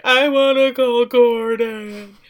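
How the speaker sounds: slow, loud, drawn-out whine; mock whining. Daughter begging mother to phone, mock whining. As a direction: whine it out like a child.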